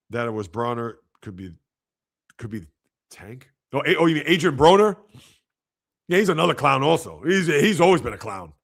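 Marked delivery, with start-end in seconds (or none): none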